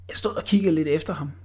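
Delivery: plain reading voice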